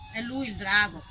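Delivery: plain reading voice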